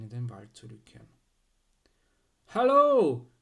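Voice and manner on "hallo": loud, high hailing shout; drawn-out high call. Acted. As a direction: holler up to someone far off. Archibald calls up to Zipp.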